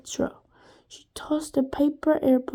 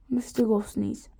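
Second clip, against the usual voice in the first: usual speaking voice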